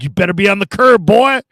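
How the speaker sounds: stern order. Retold or imitated. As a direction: lay down the law to the rookie. Coach ordering him.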